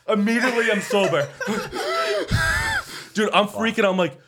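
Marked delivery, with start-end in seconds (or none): 1.3-3.1 shrieking laugh. Real, not acted. Burst of laughter.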